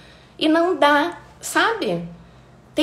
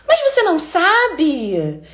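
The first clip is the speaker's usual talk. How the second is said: higher, slow, mock-incredulous; condescending disbelief; 6 semitones higher, 3 dB louder, slower than usual. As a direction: make them feel stupid for asking. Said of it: "como se o fato de você não saber, você fosse uma pessoa imbecil".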